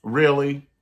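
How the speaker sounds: sassy side-eye question; unimpressed really. Retold or imitated. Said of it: "[name] gonna be like".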